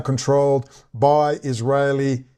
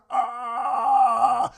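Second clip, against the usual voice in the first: high, drawn-out "aah"; long high mock cry; retold long wail; 9 semitones higher, 3 dB quieter than usual. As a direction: imitate your own stunned wail. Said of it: "And I'm there going".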